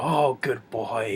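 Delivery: slow, sly mock praise; sly good boy. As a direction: drag it out like a smug villain. Repeats villain's sly praise.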